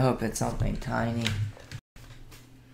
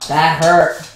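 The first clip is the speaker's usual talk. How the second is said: pained yell; 3 semitones higher, 10 dB louder than usual. Real, not acted. Pet bites him.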